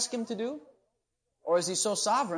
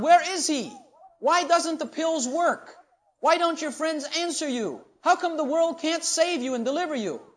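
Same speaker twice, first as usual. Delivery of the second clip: mocking taunt; 7 semitones higher, 6 dB louder than usual. Preacher voices Elijah mocking the priests, loud jeer. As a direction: taunt them like Elijah scorning Baal. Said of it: "And Elijah began to mock them".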